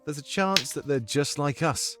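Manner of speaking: put-on musing British accent, measured phrases; Domik's comforting accent. Domik doubts the rulers are gods, musing British accent. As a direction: wonder aloud in a British accent. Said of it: "I need your comforting accent right now".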